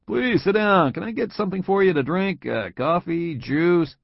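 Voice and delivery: a hint of a hospitable manager; genial boss. A narrator's reading. Boss offering Tom a drink, lightly voiced and friendly.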